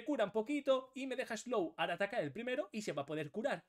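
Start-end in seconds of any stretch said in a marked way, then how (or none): none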